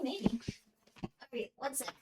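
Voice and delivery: ordinary talk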